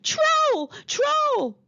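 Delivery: high, loud pretend alarm of Troll; fake shout. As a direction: shout at the top of her voice as a trick. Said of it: "she shouted".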